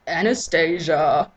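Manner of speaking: slow coaxing voice on the name; cajoling. Acted. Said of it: "he cajoles".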